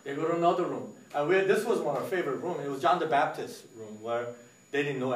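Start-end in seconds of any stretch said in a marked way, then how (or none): none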